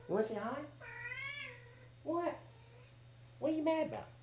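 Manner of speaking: high and soft pet voice. Real, not acted. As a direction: coo softly at the cat. Talking to the cat.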